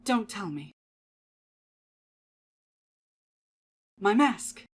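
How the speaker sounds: eerie cheerful salesman voice; sweet and unsettling, then snapping; creepy polite merchant; Happy Mask Salesman. Acted. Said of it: "The Happy Mask Salesman".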